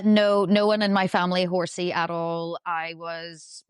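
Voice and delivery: ordinary delivery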